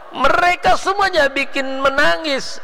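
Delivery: high, loud climax of the sermon; preaching at full force. Real, not acted. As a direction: lift the voice up to the peak. Sermon climax after verse. (berkhotbah lantang dengan suara tinggi)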